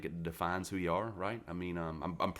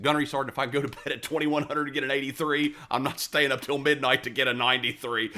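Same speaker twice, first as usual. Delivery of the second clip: cocky retort; 8 semitones higher, 8 dB louder than usual. Retold or imitated. Retold defiant reply to gunny.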